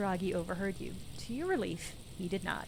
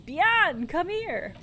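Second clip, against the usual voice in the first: raised, high yell; 8 semitones higher, 6 dB louder, much slower than usual. A narrator's reading. Man on the phone calls to the woman, yelling. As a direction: call out loudly to someone further off. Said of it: "then yells".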